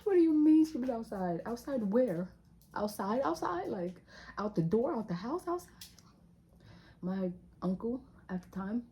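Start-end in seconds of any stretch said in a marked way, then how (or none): 0.0-5.7 much higher and louder, stunned; shocked disbelief. Real, not acted. Hearing the baby was found outside, shocked disbelief. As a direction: blurt it out in disbelief.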